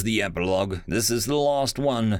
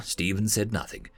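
usual speaking voice

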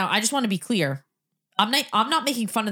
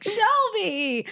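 high-pitched imitated call; calling the dog; 10 semitones higher than usual. Retelling how family called the dog, high call. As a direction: call the pet's name up high.

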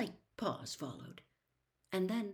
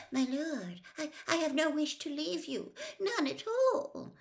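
high, stammered denial, rising higher; anxious servant protesting; 10 semitones higher, 6 dB louder than usual. Acted. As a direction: protest nervously, stretching the last words high. Servant anxiously denies desertion.

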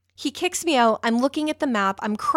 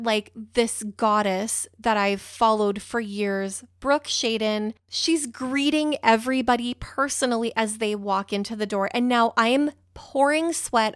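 ordinary delivery

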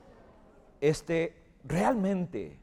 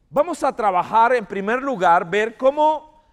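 loud, high, projected pulpit delivery; emphatic preaching; 9 semitones higher, 13 dB louder than usual. Real, not acted. Pastor opens the teaching on Saul's insecurity, loud emphatic preaching. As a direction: preach it out forcefully to the whole room.

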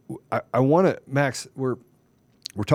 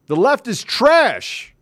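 high, slow, emphatic condemnation; angry denunciation; 9 semitones higher, 4 dB louder, much slower than usual. Real, not acted. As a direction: stretch it out in a raised, scornful voice. Political rant at opponents.

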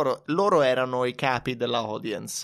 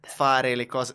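usual speaking voice

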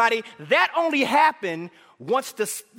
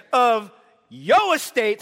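slow, stretched words of a greedy son; drawn-out demand; 4 dB louder, much slower than usual. Acted. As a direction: drag out each word of the demand.

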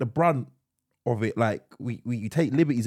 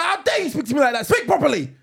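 high, loud furious scold; screaming; 11 semitones higher, 4 dB louder than usual. Retold or imitated. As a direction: scream at him, half laughing. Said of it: "I scream".

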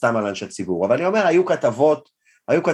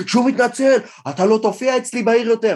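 higher, louder stern ban; mayor threatening; 10 semitones higher, 3 dB louder than usual. Retold or imitated. The mayor banning the comedian from his city, angry. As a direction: lay down the law to him.

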